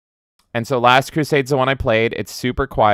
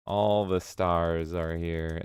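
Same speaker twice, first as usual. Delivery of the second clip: soft, slow murmur; hushed slow aside; quiet; 4 semitones lower, 9 dB quieter, much slower than usual. Acted. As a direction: say it to yourself. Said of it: "repeated quietly".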